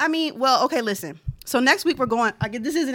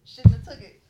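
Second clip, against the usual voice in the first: usual speaking voice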